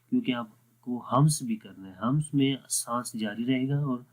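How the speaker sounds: ordinary talk, nothing marked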